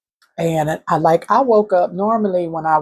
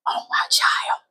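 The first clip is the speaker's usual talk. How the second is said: tearful whisper; 4 dB quieter than usual. Real, not acted. Divine voice quoted, through tears.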